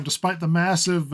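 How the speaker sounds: usual speaking voice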